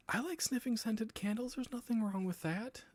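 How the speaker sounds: high-pitched, put-on defensive voice; squeaky mock self-defense. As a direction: protest your innocence way up high. Mock defense of sniffing candles.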